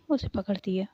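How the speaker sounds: usual speaking voice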